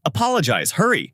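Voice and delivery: plain reading voice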